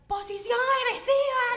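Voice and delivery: high, slow, loud drill call; instructor command. Retold or imitated. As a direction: bark it like a fitness coach. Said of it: "ella dice en medio de la clase".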